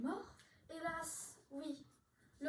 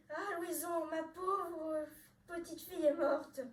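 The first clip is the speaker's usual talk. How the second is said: slow, tearful and louder; sobbing lament; 4 dB louder, slower than usual. Acted. Father mourning his little girl, tearful slow lament. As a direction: weep the words over her. Father weeps over daughter.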